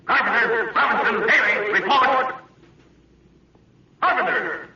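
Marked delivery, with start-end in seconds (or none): 4.0-4.7 rising high calls into silence; calling out. Acted. Captain calling for his missing officers, anxious calls.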